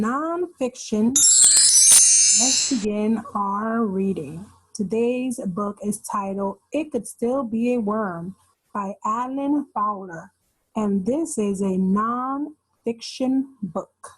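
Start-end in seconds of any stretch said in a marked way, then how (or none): none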